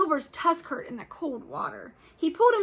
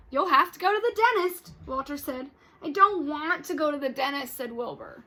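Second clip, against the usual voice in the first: lively read-aloud with character voices; storybook read-aloud for kids; reading to children; 4 semitones higher, 9 dB louder than usual. Acted. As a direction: read it to the class with expression.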